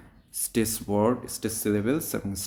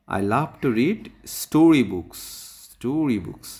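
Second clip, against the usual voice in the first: "story books" louder, higher and drawn out; stress on story books; 3 semitones higher, 7 dB louder, much slower than usual. Shown as a demonstration. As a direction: punch "story books" slowly for a class. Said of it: "story বুকসের উপর stress দেওয়া হয়". ("story books"-এ জোর দিয়ে)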